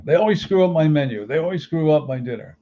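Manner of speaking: mimicking the mother-in-law's loud, high-pitched complaint about dinner; complaining mother-in-law. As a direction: imitate a fussy diner's whine.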